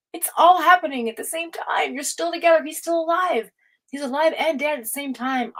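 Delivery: mother lightly imitating her son's calm explanation; voicing her son. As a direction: play the patient kid teaching mom. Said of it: "He said".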